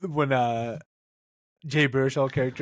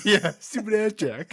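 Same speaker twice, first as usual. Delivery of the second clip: higher, slower put-on voice; echoing the impression, higher and slow; mock character voice; 9 semitones higher than usual.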